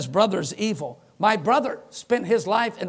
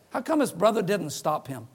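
ordinary talk

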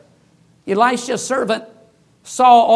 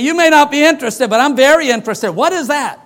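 pushy retort; 5 semitones higher, 6 dB louder than usual. Retold or imitated. Preacher retorts to witch at door.

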